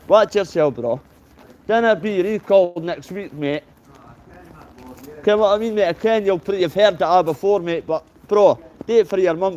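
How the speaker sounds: loud, high street shout to a passing mate; calling out. Real, not acted. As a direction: hail him loudly from across the road.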